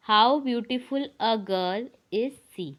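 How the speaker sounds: spaced out slowly, word by word; slow dictation. Shown as a demonstration. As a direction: dictate the right order slowly. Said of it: "sentence इसका सही होता है". (सही वाक्य धीरे धीरे)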